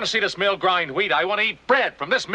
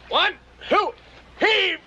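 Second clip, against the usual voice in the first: hauling shout, much higher; straining call; 14 semitones higher, much slower than usual. Acted. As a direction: call the count while lifting. Brothers lifting heavy wheel.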